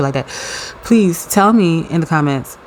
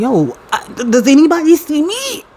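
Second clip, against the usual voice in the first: much higher, whiny mock plea; hammed-up pleading to be noticed; mock ignored whine; 11 semitones higher than usual. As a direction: play the overlooked friend, whining. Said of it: "I'm gonna be like".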